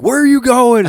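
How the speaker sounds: high, loud retold call; imitated calling after. Buddy calling to friend storming off, high loud imitation. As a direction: call it down the street, mimicking a friend.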